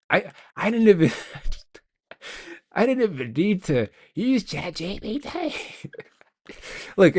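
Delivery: high-pitched, breaking into laughter; laughing too hard to finish cleanly; amused disbelief. Real, not acted. Amused at not needing the chatbot.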